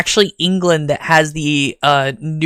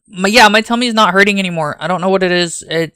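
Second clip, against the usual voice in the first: ordinary talk, nothing marked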